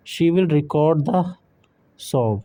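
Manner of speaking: "record" with the stress on the second syllable, re-CORD; record as a verb. Shown as a demonstration. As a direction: lean on "cord": re-CORD. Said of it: "एक है रिकॉर्ड". ("record" में ज़ोर दूसरे हिस्से पर: रि-कॉर्ड)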